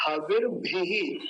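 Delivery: हविर भी spelled out slowly, each syllable clear; pronunciation drill; slow careful Sanskrit. Shown as a demonstration. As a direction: sound out हविर भी slowly like a Vedic teacher. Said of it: "विसर्ग भी बना हुआ है".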